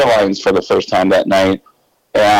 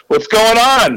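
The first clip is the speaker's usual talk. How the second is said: startled question; 12 semitones higher than usual. Retold or imitated. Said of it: "and I went".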